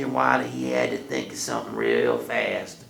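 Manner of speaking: ordinary delivery, nothing marked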